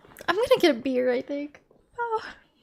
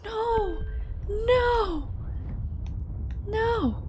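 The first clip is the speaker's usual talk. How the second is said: whiny no; 7 semitones higher, much slower than usual. Real, not acted. Refusing to redo puzzle.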